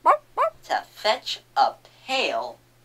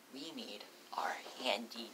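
whispering; 6 semitones lower, 12 dB quieter than usual. Real, not acted. Whispered notebook call.

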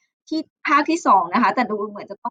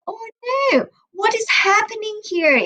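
high-pitched storybook "oh no"; playful high dismay; dismayed exclamation; 9 semitones higher, much slower than usual. A narrator's reading. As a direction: react to a mishap, pitched way up. Story mishap, teacher exclaims.

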